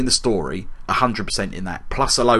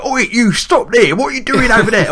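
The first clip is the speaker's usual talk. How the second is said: high, loud hammed-up Cockney shout; really Cockney; 11 semitones higher, 6 dB louder than usual. Mocking game characters challenging someone, loud exaggerated Cockney. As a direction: imitate a Cockney street challenge. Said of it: "either Cornish or really Cockney".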